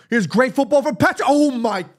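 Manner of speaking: excited call. Real, not acted. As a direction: lift it up as the move opens. Fan cheering a great move.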